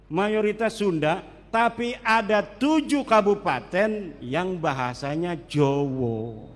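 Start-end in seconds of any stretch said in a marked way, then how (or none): 5.5-6.5 Jawa said low as "Jowo", both vowels rounded to o; Javanese accent. Shown as a demonstration. Preacher showing how the locals say Jawa, "Jowo" with rounded o. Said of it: "Tapi Jawanya lucu".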